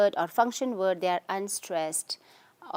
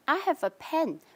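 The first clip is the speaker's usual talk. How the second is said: raised voice pitch, "pen" stressed; 6 semitones higher than usual. Shown as a demonstration. Teacher repeating the example for students, stress on PEN. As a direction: make "pen" the stressed word. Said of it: "Pen I'm stressing".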